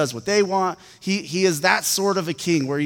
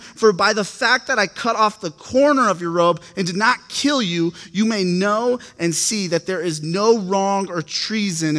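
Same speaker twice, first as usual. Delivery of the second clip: emphatic, raised scripture-reading voice; 3 semitones higher, 5 dB louder than usual. Acted. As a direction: read the passage out with measured force. Reads scripture aloud.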